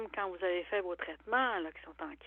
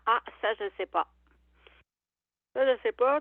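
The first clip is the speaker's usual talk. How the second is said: shrugging agent, louder, higher; customer service voice; 3 semitones higher, 9 dB louder than usual. Retold or imitated. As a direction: toss off a careless no idea. Agent's reply acted out.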